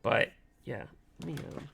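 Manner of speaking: losing your voice, hoarse. Real, not acted. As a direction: voice going hoarse and cracking. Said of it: "I don't know why my voice is going out again".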